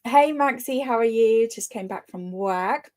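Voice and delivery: ordinary delivery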